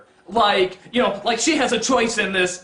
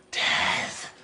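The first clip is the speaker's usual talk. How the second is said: hushed whispered pronouncement; soft, voiceless and foreboding; ominous character quote; 7 dB quieter than usual. Acted. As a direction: whisper it like a dire omen. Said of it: "the Spanish equivalent of".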